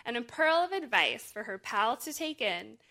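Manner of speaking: laughing into the read-aloud; lively picture-book narration; storybook reading. Acted.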